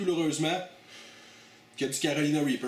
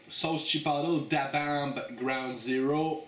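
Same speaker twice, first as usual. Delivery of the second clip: announcer voice; much slower than usual. Acted. Announcing the sauce before tasting.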